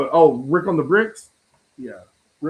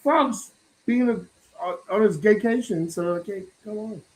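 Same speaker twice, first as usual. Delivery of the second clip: a lightly voiced, higher greeting; imitating Don; 7 semitones higher than usual. Imagining how Don answered the invite, lightly voiced greeting. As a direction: play Don breezily saying hi. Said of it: "he's like".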